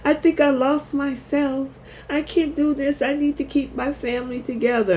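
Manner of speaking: high, helpless voice playing the frazzled girl; hammed-up high-pitched fretting; mock panicky Hope. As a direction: whine it out like a girl who's lost herself.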